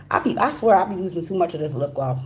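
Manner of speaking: ordinary talk, nothing marked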